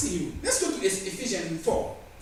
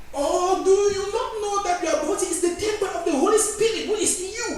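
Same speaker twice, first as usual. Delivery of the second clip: higher, louder preacher's reading of the verse; proclaimed scripture; 11 semitones higher, 5 dB louder than usual. Acted. Preacher proclaiming scripture to the church, raised and ringing. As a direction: ring the verse out to the congregation.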